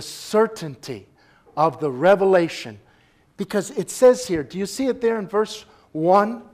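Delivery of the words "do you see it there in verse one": ordinary delivery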